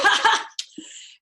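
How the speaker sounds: mean laugh. A narrator's reading. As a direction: cackle at the dead man's memory.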